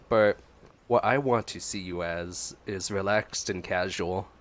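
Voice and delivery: ordinary talk, nothing marked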